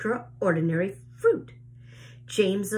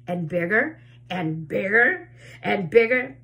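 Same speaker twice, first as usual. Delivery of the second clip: plain reading voice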